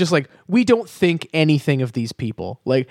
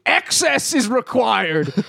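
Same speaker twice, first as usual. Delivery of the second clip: higher, slow mock pronouncement; a slow, higher mock corporate decree; 7 semitones higher, slower than usual. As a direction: proclaim it like a greedy company motto. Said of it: "it's just like".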